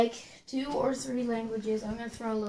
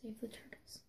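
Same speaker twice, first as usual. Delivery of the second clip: hushed, unvoiced whisper; 20 dB quieter than usual. Contestant slips a plea into the sign-off, whispered. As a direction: slip it in as a whisper.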